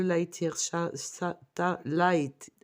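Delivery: slow, careful English accent on Light Years Tarot. Shown as a demonstration. Tarot reader naming her deck to viewers, slow self-mocking English accent. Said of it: "avec mon super accent anglais".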